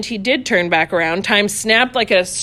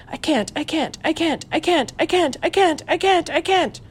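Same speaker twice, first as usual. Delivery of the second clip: soft, high, panicky repetition to herself; muttered to herself; 6 semitones higher, 5 dB quieter than usual. A narrator's reading. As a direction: chant it under her breath in terror. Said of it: "It wasn't loud at first".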